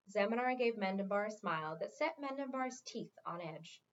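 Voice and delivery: ordinary delivery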